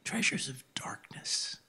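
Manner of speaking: slow, hushed whisper; the Lord speaking. Retold or imitated. The Lord answering a frustrated man in the hospital room, whispered. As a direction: whisper it slowly, like a revelation. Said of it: "like the Lord spoke to me".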